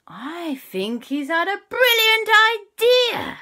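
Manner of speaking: high, slow, sing-song; a sing-song kid announcing a discovery; Jack's voice. Acted. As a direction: croon it out in a lilting boy voice.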